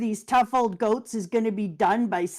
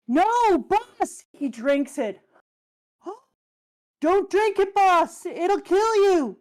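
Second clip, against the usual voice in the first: panicked cries; 9 semitones higher, 4 dB louder, much slower than usual. Acted. Voicing panicked crew cries.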